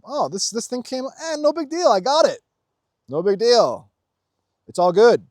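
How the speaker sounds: high-pitched, punchy shrug-off; breezy confidence. Acted. Showing the "I got this" attitude when things come, breezily unfazed. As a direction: wave the trouble away, pitched well up. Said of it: "It's an attitude of, "I got this".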